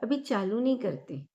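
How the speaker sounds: calm, measured meditation guide with long pauses; guided meditation. Acted. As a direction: lead listeners gently through each breath, leaving space after every phrase. (शांत, ठहराव भरी ध्यान कराने वाली आवाज़)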